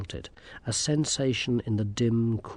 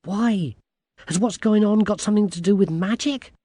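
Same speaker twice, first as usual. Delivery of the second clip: put-on higher, louder, quick girl's questions; lightly voiced eager girl, questioning; 9 semitones higher, 5 dB louder than usual. A narrator's reading. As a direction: ask it urgently as a young girl.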